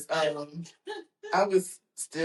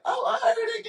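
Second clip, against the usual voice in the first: much higher, mock-innocent answer; high squeaky reply. Answering brother about hearing the shots, high and squeaky. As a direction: play yourself as a scared kid. Imitating himself, high-pitched.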